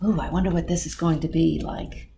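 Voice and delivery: ordinary delivery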